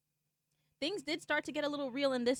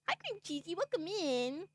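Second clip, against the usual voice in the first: high, welcoming hello; welcoming a new chatter; cheery greeting; 8 semitones higher than usual. Real, not acted. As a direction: greet the viewer sweetly. Greeting a chat member.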